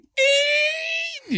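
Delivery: very high squealing laugh imitation; shrill mock screech laugh. Host imitating Bert's screeching laugh, very high squeal. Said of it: "Bert does that laugh".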